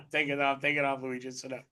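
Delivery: giggling at yourself while teasing; laughing. Real, not acted. Host laughing at his own screaming video, softly laughing.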